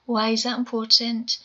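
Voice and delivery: ordinary delivery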